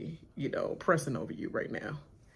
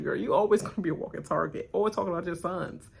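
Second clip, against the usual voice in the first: ordinary talk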